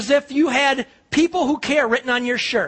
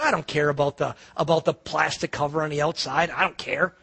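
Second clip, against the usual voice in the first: reassuring; 7 semitones lower than usual. Retold or imitated. Retelling his reassurance to the girl.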